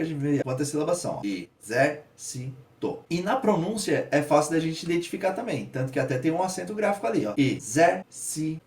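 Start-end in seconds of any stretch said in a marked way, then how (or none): 1.2-2.7 "Exército" very slowly split into e, zér, ci, to; long gaps between syllables of "Exército". Shown as a demonstration. Teacher counting syllables for students, slowly syllable by syllable. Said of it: "fazendo a separação silábica". 7.4-8.6 "Exército" slowly split, ZÉR standing out; e, ZÉR, ci, to with pauses; stressed syllable demo. Shown as a demonstration. Teacher showing the tonic syllable, slow split with stressed ZÉR. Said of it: "ele tem uma ascendência".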